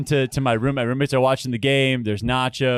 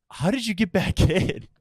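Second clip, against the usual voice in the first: surprised nurse; 5 semitones higher than usual. Retold or imitated. Nurse baffled he returned.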